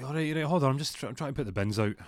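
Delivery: a hurried husband calling back to his wife; harassed husband. Retold or imitated. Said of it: "Jack's like".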